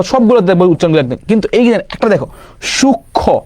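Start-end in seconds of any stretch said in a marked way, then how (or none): 2.6-3.4 সূক্ষ্ম said shuk-kho; silent letter demo. Shown as a demonstration. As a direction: drop the final m sound from সূক্ষ্ম. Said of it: "এটা একদম উচ্চারিত হয় না".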